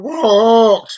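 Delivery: high, loud mock-exasperated answer; exasperated reply. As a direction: snap back loudly at the nagging. Exasperated answer to repeated calls.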